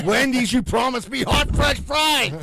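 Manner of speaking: slow, loud hammed-up protest; mock customer outrage. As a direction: wail it like a cheated customer. Acted outraged fast-food customer.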